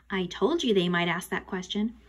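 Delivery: usual speaking voice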